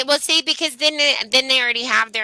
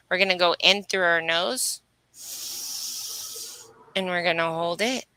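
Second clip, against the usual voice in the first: guided meditation; 3 semitones lower, 4 dB quieter than usual. Acted. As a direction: ease them through each breath, unhurried. Leads breathwork healing meditation.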